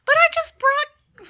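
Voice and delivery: high, loud, drawn-out protest; unhappy gnome. Acted. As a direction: burst out in frustration as the gnome. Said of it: "a very unhappy gnomish voice".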